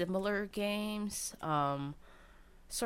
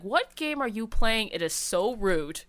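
mom's mock yell, louder at the start; retold yell; 5 semitones higher, 7 dB louder than usual. Mom yelling from the other room about the game, mock scolding yell. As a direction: call out a scolding, playfully imitated. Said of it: "she yelled from the other room".

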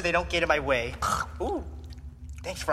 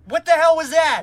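angry; 8 semitones higher, 9 dB louder than usual. Acted. Angry at teammate after betrayal.

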